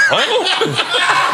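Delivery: very high, laughing huh; a squeaky baffled huh. Retold or imitated. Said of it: "he's like".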